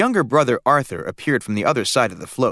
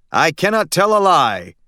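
higher, louder, slow and solemn; intoning father; 6 semitones higher, 5 dB louder than usual. A narrator's reading. Father playing George Washington intones grandly, slow and loud. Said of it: "intoned his father".

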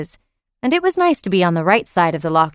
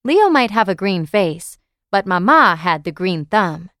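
ordinary delivery, nothing marked

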